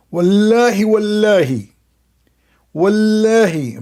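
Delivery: a hint of a mocking imitation; mocking wallahi. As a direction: parody his pleading oath. Mocking the guy's oath.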